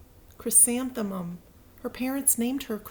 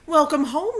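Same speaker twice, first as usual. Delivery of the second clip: cheerful greeting; 7 semitones higher, 6 dB louder than usual. Acted. Mother greets daughter warmly.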